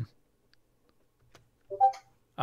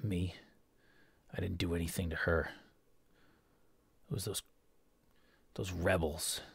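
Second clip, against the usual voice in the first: soft, hushed protest of innocence; a quiet, weak governor shifting blame; governor denying blame; 5 semitones lower, 10 dB quieter than usual. Acted. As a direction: deny it quietly, deflecting to the rebels. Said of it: "and he says".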